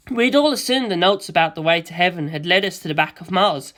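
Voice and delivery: ordinary delivery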